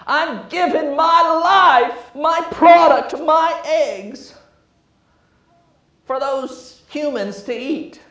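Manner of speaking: lamenting chicken. Acted. Preacher plays the chicken mourning its eggs to humans, high and slow. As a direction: stretch each word like a martyred hen.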